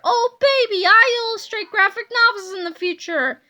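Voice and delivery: high, loud crowing; gleeful shout. A narrator's reading. Boy revels in his future career, crowing loudly. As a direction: whoop it up and crow. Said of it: "screamed Harold".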